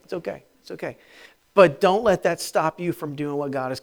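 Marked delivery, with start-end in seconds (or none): 0.0-1.0 reassuring. Shown as a demonstration. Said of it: "Turn to somebody and tell them that".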